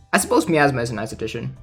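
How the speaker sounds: ordinary delivery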